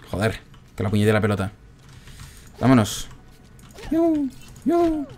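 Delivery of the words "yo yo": very high falsetto yelps; squeaky "yoo" noises. Real, not acted. Player making noises during play, tiny falsetto squeaks. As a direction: squeak two little high "yoo" sounds.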